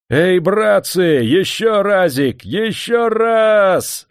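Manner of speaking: high, drawn-out work call; slow, loud heave-ho cry stretched on the last words; rallying shout. A narrator's reading. Workers urging each other on at the dam, rallying shout. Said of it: "подбадривали они друг друга криком".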